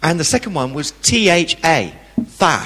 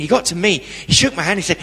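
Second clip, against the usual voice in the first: ordinary talk, nothing marked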